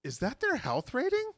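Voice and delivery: high, startled question; surprised. Retold or imitated. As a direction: ask it in disbelief. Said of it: "And I thought".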